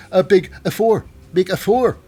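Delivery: excited announcement. Real, not acted. Host announcing Nathan Frazer's first victory, excited. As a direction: call it out big, pitch jumping up.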